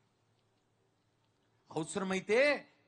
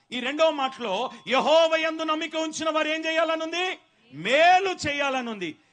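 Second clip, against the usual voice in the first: loud, higher sermon cadence; preaching at full force; 6 semitones higher, 9 dB louder than usual. Real, not acted. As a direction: drive the point home. (ఉద్రేకంతో ప్రసంగం)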